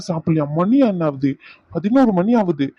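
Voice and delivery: ordinary talk, nothing marked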